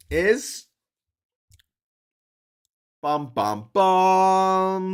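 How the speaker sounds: loud fanfare bum bum bum; announcing the next country with a fanfare; drumroll. Acted. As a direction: build a mock drumroll.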